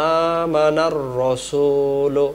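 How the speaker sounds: আমানার রাসূলু with the vowels drawn out long; elongated recitation. Shown as a demonstration. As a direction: stretch আমানার রাসূলু slowly. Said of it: "আর এখানে টান দিতে হবে". (আমানার রাসূলু টেনে পড়া)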